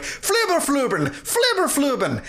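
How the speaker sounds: high-pitched panicky gibberish; high mock yell without much added volume; mock Sim-language yelling. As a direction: imitate panicked game characters jabbering nonsense. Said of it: "they're yelling in Sim language".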